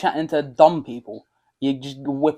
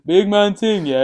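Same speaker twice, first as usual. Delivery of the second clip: higher, louder roadman voice; roadman slang; 6 semitones higher, 5 dB louder, slower than usual. Retold or imitated. Echoing the catchphrase they kept saying, roadman voice. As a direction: say it like a London roadman. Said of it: "That's all we kept saying".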